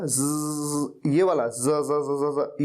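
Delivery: "ज" as a long buzzing z, then repeated; buzzed z sound. Shown as a demonstration. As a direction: drone the z in "ज" slowly. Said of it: "ये वाला ज का sound".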